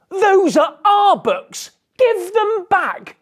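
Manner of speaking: very high, loud, indignant yell; children yelling. Acted. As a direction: shout it like a crowd of upset kids. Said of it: "the children yelled".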